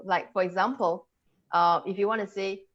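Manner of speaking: ordinary talk, nothing marked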